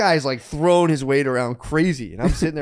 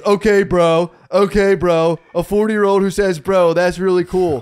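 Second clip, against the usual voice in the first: louder, higher sarcastic jeer; retold mocking jeer; 5 semitones higher, 7 dB louder, slower than usual. Storyteller voicing rival customer mocking his age, loudly sarcastic. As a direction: imitate a taunt, punching each phrase.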